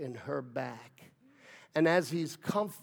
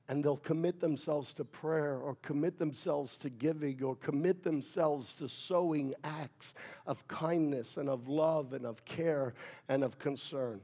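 usual speaking voice

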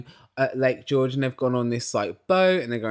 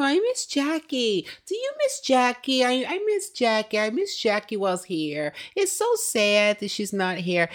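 high, put-on sorrowful castmate voice, pitched way up; hammed-up mock pity, much higher than normal; mocking fake sympathy; 9 semitones higher than usual. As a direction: parody the castmates' fake sadness over Jackie. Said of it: "the kind of attitude that they have".

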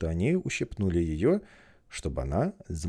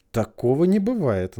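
cross, raised voice; angry; 8 semitones higher, 9 dB louder than usual. Acted. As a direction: snap it crossly. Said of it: "очень сердито".

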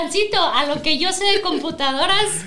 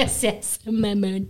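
sexy voice; 7 semitones lower, 3 dB quieter, much slower than usual. Pretend. Joking sexy radio voice.

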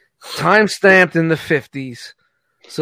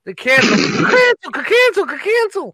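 high, crow-like cancel, cancel; 12 semitones higher than usual. Retold or imitated. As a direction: squawk the word like a crow. Mocks cancel culture as crows.

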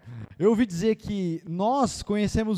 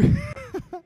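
short high laugh. Real, not acted. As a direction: burst out laughing. Laughs at own story.

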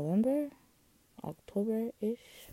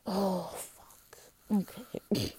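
whispered; 4 semitones higher, slower than usual. Real, not acted. Reacting to sad memory.

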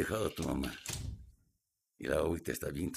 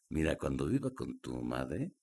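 ordinary talk, nothing marked

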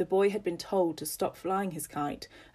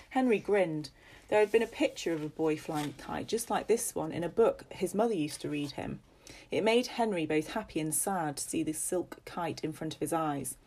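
ordinary delivery, nothing marked

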